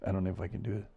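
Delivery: usual speaking voice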